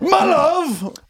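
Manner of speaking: high, theatrical mock declamation; mock the line like a stage actor; hammed-up thespian. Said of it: "You thespian bitch".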